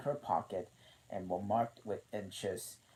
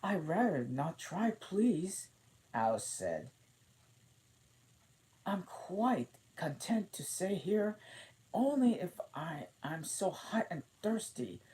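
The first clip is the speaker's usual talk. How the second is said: Alice voice; 10 semitones higher, 3 dB louder than usual. A narrator's reading. Alice declines politely.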